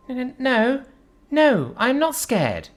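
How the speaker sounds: high, quivering stammer; scared stammer. Acted. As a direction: stammer out a frightened denial. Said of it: "Ray stammered out, her quivering voice".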